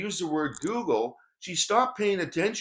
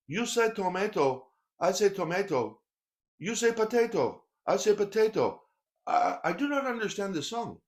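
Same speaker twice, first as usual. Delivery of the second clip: put-on higher-pitched Korean-accented English; imitated Korean accent; 4 semitones higher than usual. Korean friend puzzling over song lyrics, Korean accent. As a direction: do a confused Korean man reading lyrics, every vowel alike. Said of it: "try to imitate his English accent".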